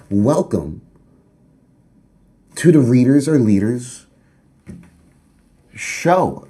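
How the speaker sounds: loud showman intro to the audience; show host opener. Pretend. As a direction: boom it out like opening a TV show. Host opens his show.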